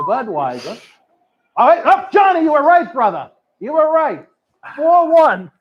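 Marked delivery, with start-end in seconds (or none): 1.6-5.5 loud, high, triumphant call of the result; excited shout. Real, not acted. Co-host cheering Johnny's correct call, loud and high excited shout. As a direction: cheer that Johnny was right.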